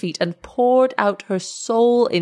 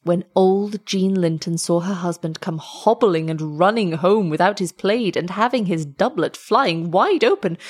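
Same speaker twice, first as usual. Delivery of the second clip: ordinary delivery, nothing marked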